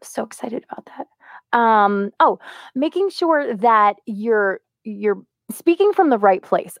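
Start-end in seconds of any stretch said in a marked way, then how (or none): 0.0-1.6 sarcastic whisper. Real, not acted.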